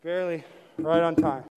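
panting after rushing in; breathless from hurrying, out of breath. Real, not acted. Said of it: "let me catch my breath".

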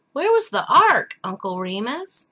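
put-on high, loud, eager child's question; a small boy bursting with curiosity; little boy asking. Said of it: "asked the little boy".

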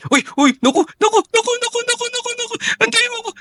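extremely high shriek of panic; terrified squeal. Acted. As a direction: squeal in terror to be waited for. Panic as killer nears. (matinding takot, napakataas na tili)

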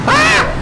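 high, short yelp of fright; sudden high-pitched shriek; startled scream. Real, not acted. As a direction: scream as if nearly crashing. Nearly runs into enemy.

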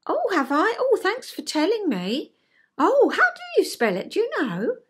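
high, lilting grateful reply; mildly surprised and glad to be told; receptive surprise. Acted. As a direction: take the correction brightly, pitched well up. Acts receptive reply to gentle correction.

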